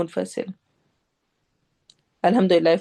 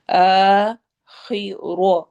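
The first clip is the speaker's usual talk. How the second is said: آخرہ drawn out slowly with a heavy ra; slow correct recitation; 4 dB louder, much slower than usual. Shown as a demonstration. Teacher shows students the slow, correct آخرہ. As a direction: stretch it out properly. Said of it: "روا کو heavy کرنا ہے".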